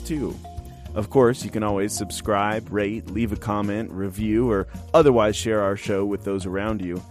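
ordinary talk, nothing marked

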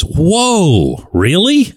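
a player's wide-eyed surprise, played back; amazed whoa. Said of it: "and he goes".

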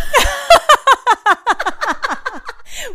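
high burst of laughter; loud laugh. Real, not acted. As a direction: crack up loudly.

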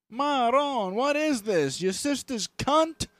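high, loud-edged Italian-American goombah; drawn-out Italian-American outrage. Acted. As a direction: do an indignant Italian-American wise guy. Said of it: "we're getting Italian on this one".

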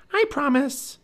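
put-on high, dutiful young girl; little girl voice. A narrator's reading. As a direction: promise in a high girl's voice. Narrator voices Annie.

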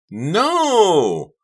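very high, loud, drawn-out howl; long howl. A narrator's reading. Karina dives at Delia, long howl. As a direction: howl it out at full stretch. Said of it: "let out a long howl".

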